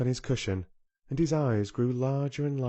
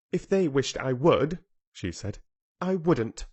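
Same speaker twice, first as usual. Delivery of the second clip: contrary; 5 semitones higher, 5 dB louder than usual. A narrator's reading. Contrary Mary retorts.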